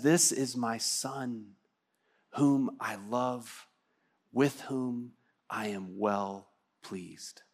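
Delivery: slow, hushed, loving blessing; tender father's voice. Acted. The Father blesses his Son at the baptism, slow and hushed. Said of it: "He says".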